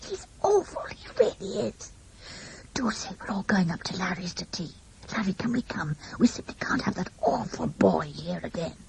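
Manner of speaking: hushed, desperate pleading; agonized whisper. A narrator's reading. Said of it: "in an agonized whisper".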